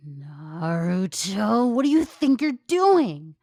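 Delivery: slow then high loud scolding; loud high furious scold; menacing Sakura. Acted. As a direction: loom over him before you snap. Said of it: "A shadow loomed behind Naruto".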